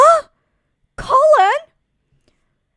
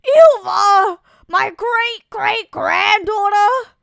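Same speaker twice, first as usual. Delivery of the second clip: loud, higher anguished cry; shocked shout; 5 semitones higher, 7 dB louder than usual. Acted. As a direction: cry out the child's name in shock. Elder shocked at grandchild's name.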